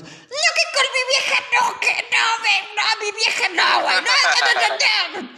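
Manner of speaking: very high, loud shouting; mock jealous yell. Boyfriend shouting that his girl is off-limits, hammed-up shriek. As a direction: scream it like a possessive boyfriend.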